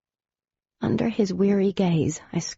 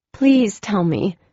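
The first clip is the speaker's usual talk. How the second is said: urgent, louder pleading; warning; 4 semitones higher, 6 dB louder than usual. A narrator's reading. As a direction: push him, close to breaking. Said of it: "I warned him".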